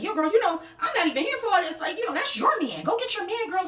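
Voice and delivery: high, loud egging-on; hyping up. Retold or imitated. Guest urging the ex to get her man, high loud hype. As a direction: hype the girl up with insistent energy. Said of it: "So I'm hyping it up".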